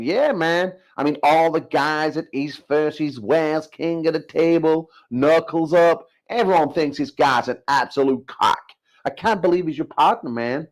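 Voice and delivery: high-pitched, drawling mock American; bad American accent. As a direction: put on a hammy American voice. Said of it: "I'm doing a bad American accent".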